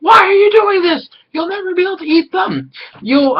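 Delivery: very high squeaky piglet voices; squeaky pigs. Retold or imitated. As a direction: squeal it like worried little pigs pleading. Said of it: "They're like".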